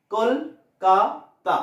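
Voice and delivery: কল, কা, তা with pauses; three-part slow demonstration of কলকাতা; syllable-by-syllable. Shown as a demonstration. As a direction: say কলকাতা very slowly in three separate beats. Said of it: "তিনবার আমরা স্বর change করলাম".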